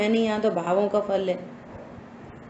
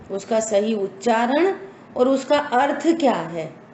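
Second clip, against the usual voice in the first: usual speaking voice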